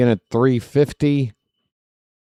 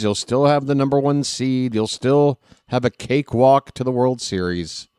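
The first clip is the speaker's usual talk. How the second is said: ordinary talk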